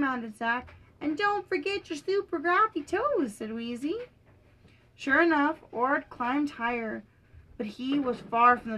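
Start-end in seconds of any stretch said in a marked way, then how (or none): 1.0-3.3 high-pitched storybook dragon; high cartoon-like encouragement; playful character voice. A narrator's reading. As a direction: pitch it up as a perky little helper. Voicing Wheezy coaching her friend.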